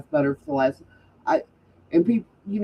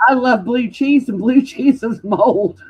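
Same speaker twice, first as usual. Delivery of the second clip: laughing through the words, slowed; 6 semitones higher, 6 dB louder than usual. Real, not acted. To a friend about blue cheese, laughing. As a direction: giggle as you admit it.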